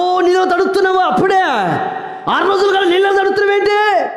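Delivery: mock an elder's alarm; scolding elder. Elder scolding the new mother for getting wet, high and fast.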